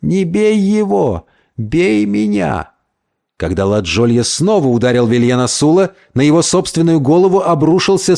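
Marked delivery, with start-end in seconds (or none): 0.0-2.7 high, drawn-out pleading; tearful plea. A narrator's reading. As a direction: beg slowly, offering yourself instead. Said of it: "с мокрыми от слёз глазами". (слёзная протяжная мольба)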